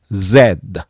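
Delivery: Z as "zed", ending on d; British Z. Shown as a demonstration. Teacher giving their own usage, British "zed" for Z. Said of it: "イギリスではZ".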